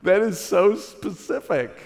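slow, laughing it's-so-specific; laughing disbelief. Retold or imitated. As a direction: chuckle through the words slowly. Amused disbelief at daughter's prophecy.

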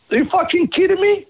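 sudden high-pitched disbelieving cry; shocked outburst. Retold or imitated. Retelling his partner selling the business, high shocked outburst.

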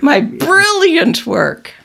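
hammed-up, drawn-out self-importance; mock pomposity. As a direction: proclaim it as a vain doctor. Joins in as boastful doctor.

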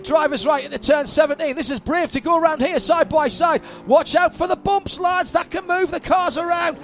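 rising, fast race call; excited play-by-play. Real, not acted. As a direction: call the overtake live. Live play-by-play.

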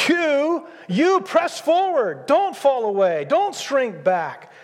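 high, slow, pressing plea; soaring exhortation. Acted. Preacher urging the church to press forward, pitch climbing high. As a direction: lift your voice and urge them on.

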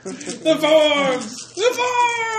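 high, loud wailing cry; frantic cry. Acted. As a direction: wail it in panic. Frantic cry about the paperwork.

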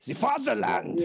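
"The fatherland" said high in a hammed-up German accent; mock German fatherland. Joking about his trip to Austria, mock German accent.

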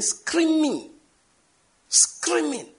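"screaming" pitched high and drawn out; emphatic stress on screaming. Shown as a demonstration. Preacher insisting he isn't exaggerating, high emphatic "screaming". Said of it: "When I say screaming".

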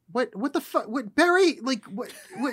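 high, baffled disbelief; what the hell, disbelieving; incredulous. Real, not acted. Outraged at film scene.

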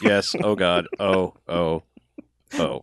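deadpan anchor reading moans; newscaster voice moaning. Retold or imitated. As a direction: moan it like a news anchor. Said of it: "doing it in newscaster voice".

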